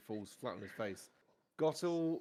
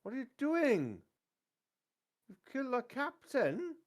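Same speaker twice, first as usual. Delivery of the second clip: outraged shouting; 11 semitones higher, 5 dB louder than usual. Acted. Farmhouse men find their captain killed, outraged shouting. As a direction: yell in shock and accusation. Two men discover the killing, game master acts.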